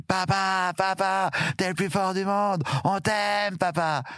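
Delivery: high, lightly voiced children shouting to their dad; a hint of young boys calling from the clifftop; sons calling out. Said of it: "j'ai entendu". (voix d'enfants qui appellent leur papa, aiguë)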